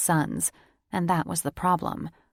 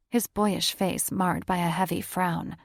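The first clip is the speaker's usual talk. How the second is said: ordinary delivery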